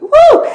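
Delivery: real burst of joy, a very high whoop with a laugh; excited whoop. Real, not acted. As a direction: let out a gleeful cheer. Excited about being present.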